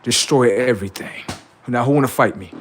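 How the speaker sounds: usual speaking voice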